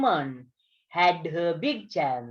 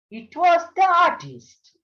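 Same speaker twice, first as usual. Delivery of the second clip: dramatic reveal; 11 semitones higher, 4 dB louder than usual. A narrator's reading. Story climax, artist bursts in.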